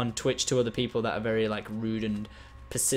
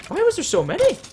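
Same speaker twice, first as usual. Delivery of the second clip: high, loud disbelieving question; incredulous squawk; 6 dB louder than usual. Real, not acted. Player after creeper swarm, incredulous high outburst. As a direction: shoot the pitch right up, aghast.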